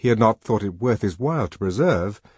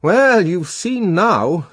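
high, loud, drawn-out retort; reproachful friend; 10 semitones higher, 8 dB louder, much slower than usual. A narrator's reading. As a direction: draw it out in a raised I-told-you-so voice. Bernard reproaches his friend.

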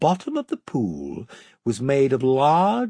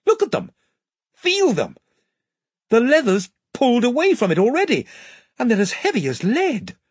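higher, urgent and fast; 9 semitones higher, 3 dB louder than usual. Acted. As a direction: press them to look, alarmed. Edmund in alarm.

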